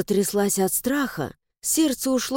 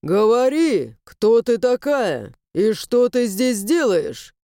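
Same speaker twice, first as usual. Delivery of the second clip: stern demand; 3 dB louder, slower than usual. Acted. Stranger interrogates at swordpoint.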